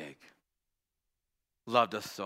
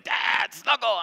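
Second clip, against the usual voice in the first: high, loud, whiny mock plea; dad mocking his teenage daughter begging to snuggle; mock teen demand; 11 semitones higher, 7 dB louder, much slower than usual. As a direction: ham up a clingy kid begging. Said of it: "she shouldn't be like".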